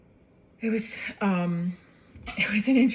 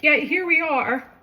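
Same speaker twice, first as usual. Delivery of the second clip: higher, emotion welling up in the voice; holding back tears while quoting herself, higher; voice breaking; 8 semitones higher than usual. Real, not acted. Telling her sister she has cancer.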